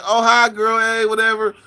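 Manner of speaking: high-pitched mimicked greeting; imitating drive-through women. Drive-through worker greeting his girl, high mimicry. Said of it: "where they be like".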